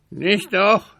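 high pleading raven; begging raven. A narrator's reading. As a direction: coax the boy, raven-like. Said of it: "bat der Rabe". (hohe, bittende Rabenstimme)

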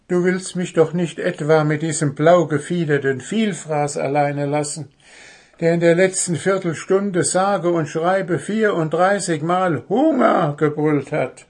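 indignant protest. A narrator's reading. Goblin begs not to be left with greedy bird, indignant.

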